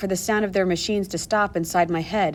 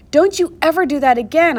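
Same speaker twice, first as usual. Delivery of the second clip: breathless scolding; 7 semitones higher, 5 dB louder than usual. A narrator's reading. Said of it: "trying to catch my breath".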